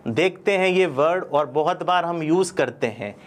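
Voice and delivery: ordinary talk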